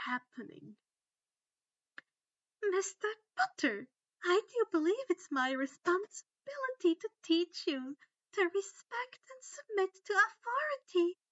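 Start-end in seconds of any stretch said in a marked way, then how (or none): 2.7-11.2 high, loud, prim menace; prim cruel lecture. A narrator's reading. Umbridge lecturing Harry on submitting to authority. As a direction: scold him with sweet authority.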